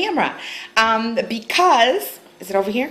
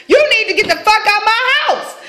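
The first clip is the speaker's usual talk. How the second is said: retold yell; 7 semitones higher than usual. Kicking boyfriend out over the donut, shouting. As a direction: yell him out of the house. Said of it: "I was like".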